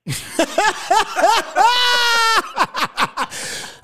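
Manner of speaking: howling with laughter; burst of laughter. Real, not acted. Hearing the voicemail played.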